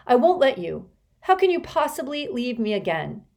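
high, loud and desperate; ferocious protest to her father; fierce. A narrator's reading. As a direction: hold him back with fierce pleading. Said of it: "with a ferocity that surprised even her".

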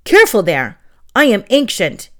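loud, huffy scolding; old book scolding the witch; indignant. Acted. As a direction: bristle with wounded pride.

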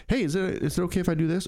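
high, meek imitation of a nervous coworker; lightly mocking an anxious new hire; timid coworker. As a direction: ask permission like a jittery new guy. Imitating timid coworker asking permission.

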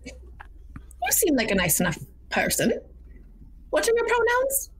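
put-on old woman asking, pitch jumping high on the question; kindly little old lady, curious; granny voice. Grandmother asking the group their pronouns, high granny voice.

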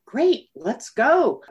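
bright, slow, delighted "Great, let's go"; eager yes. Retold or imitated. Woman recalling her reply to the Australia move, slow and eager. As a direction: jump at the offer, drawing it out. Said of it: "And I thought".

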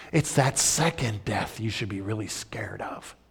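a hushed warning whisper; whispered, grave warning to the congregation. Acted. Warning of second death.

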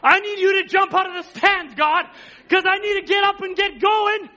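loud, high cry to God for help; shouted prayer. Pretend.

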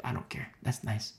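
low, soft brush-off; acted indifference, dropped low and quiet; straight-up unbothered. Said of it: "Mary's just straight up".

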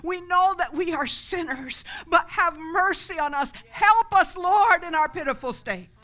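loud, high pleading; desperate cry. Retold or imitated. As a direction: beg at full voice, building to a peak. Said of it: "in their desperation".

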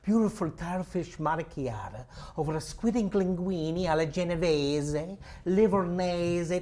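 put-on long-winded Russian-accented waiter; showy waiter's specials recital; waiter reciting specials. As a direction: roll out the specials, drawing out the dish endings. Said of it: "he does this waiter".

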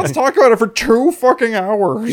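high, loud mock exclamation; mock loud outburst. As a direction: shout it as overexcited podcasters. Mocking podcasters who overanalyze the show.

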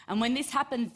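usual speaking voice

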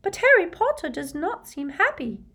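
high, timid house-elf voice; anxious little high-pitched elf; timid squeaky elf. A narrator's reading. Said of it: "looking timidly at Harry".

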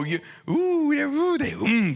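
high swooning coos over a crush; swooning ooh. Acted. Preacher swooning to the congregation, high excited coos. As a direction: melt into breathy-free high oohs.

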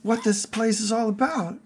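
high-pitched wide-eyed pleading; earnest Harry character. Acted.

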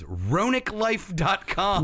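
slow, spelling it out; slow read-out. Real, not acted. Reading the site name to co-hosts, slowly and deliberately. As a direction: spell out the web address deliberately.

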